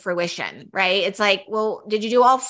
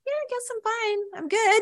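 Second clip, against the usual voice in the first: unsure, flat answer; 10 semitones higher, 5 dB quieter than usual. Retold or imitated. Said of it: "You're like".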